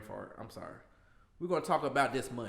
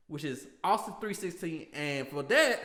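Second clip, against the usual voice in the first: ordinary talk, nothing marked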